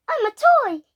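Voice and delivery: high, loud toy voice; toy Buzz. Retold or imitated. Child retelling what Buzz literally goes, in a high toy voice. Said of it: "he literally goes".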